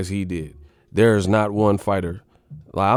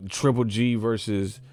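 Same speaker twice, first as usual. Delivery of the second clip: normal talking voice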